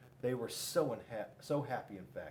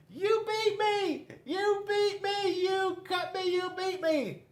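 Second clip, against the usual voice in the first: squealing defeated Paper; 5 dB louder than usual. Acted. Paper cries out after Scissors cuts it, high wailing. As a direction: wail in defeat like a tiny paper.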